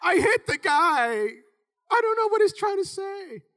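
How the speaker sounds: high, loud, bewildered; baffled kid not getting it; confused teen. Retold or imitated. Young player confused by coach's yelling, high and puzzled. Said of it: "And I'm like".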